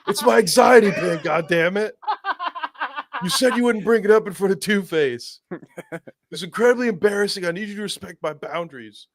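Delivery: high-pitched, indignant sidekick; Robin. Acted. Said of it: "modern take on a Robin character".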